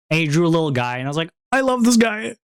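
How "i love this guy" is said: high, gushing fondness; retold delight. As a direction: pitch it way up, adoring the little drawing. Retold delighted reaction to a drawing.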